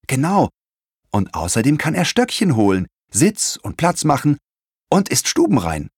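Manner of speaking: bubbling storybook enthusiasm; enthusiastic. A narrator's reading. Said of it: "fuhr Leon begeistert fort".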